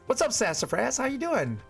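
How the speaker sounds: high, bright, lively hello; excited greeting. Real, not acted. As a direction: greet them with real delight. Greeting a chatter.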